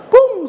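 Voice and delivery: high-pitched spoken whoosh-away pop; sudden pum. Shown as a demonstration. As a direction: burst out a high 'pum'. Imita golpe del viento. (un ¡pum! agudo y repentino)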